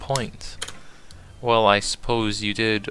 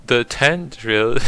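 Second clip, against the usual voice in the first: slow, exaggerated British accent; 3 semitones higher, 4 dB louder, slower than usual. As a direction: put on a fancy British voice. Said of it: "I gotta do it on British accent".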